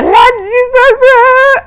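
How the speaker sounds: very high, loud, stretched wailing prayer; hammed-up tearful cry of Lord Jesus; mock sobbing wail. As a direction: wail it like you're bawling through the words. Retold sobbing prayer repeat.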